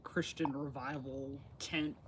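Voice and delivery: ordinary delivery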